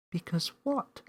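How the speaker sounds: put-on curious little girl; Millie asking. A narrator's reading. Millie voiced.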